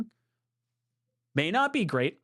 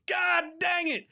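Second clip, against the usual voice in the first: mock outburst; 11 semitones higher, slower than usual. Fake rant about Dolphins fans.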